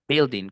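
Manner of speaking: building said "BIL-ding"; short I and no U in building; building starting with "bil"; pronounced with I. Shown as a demonstration. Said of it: "con I de indio".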